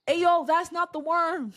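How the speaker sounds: loud, high, drawn-out call; urgent warning. Retold or imitated. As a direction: call it out urgently to someone not listening. SpongeBob repeats warning more urgently.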